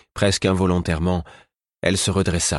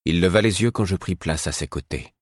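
ordinary delivery

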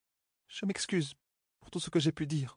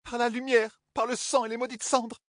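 swearing; 6 semitones higher, slower than usual. Acted. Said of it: "Quand elle les disait".

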